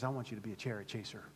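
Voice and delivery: quiet gentle call; gentle Jesus. Retold or imitated. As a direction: say it tenderly as Jesus. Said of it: "And Jesus says".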